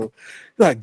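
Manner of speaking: usual speaking voice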